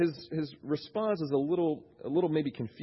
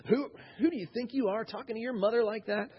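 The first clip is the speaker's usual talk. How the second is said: higher-pitched mock indignation; huffy; 8 semitones higher than usual. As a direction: act the affronted mother scolding her son. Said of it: "Mary doesn't get all huffy".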